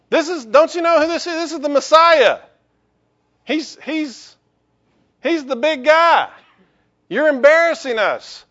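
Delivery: high, loud scandalised scolding; mock outraged crowd hushing a beggar; indignant crowd. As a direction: shame the beggar into silence.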